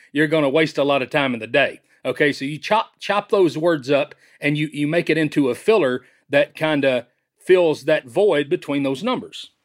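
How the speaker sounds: ordinary talk, nothing marked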